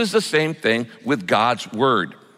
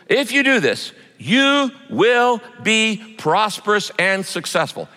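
high, ringing, word-by-word verse reading; emphatic scripture promise; 7 semitones higher, slower than usual. Acted. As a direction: proclaim the promise slowly on raised pitch.

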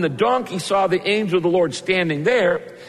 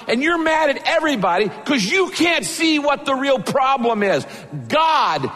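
preaching shout; 9 semitones higher than usual. Real, not acted. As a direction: drive it home at full voice.

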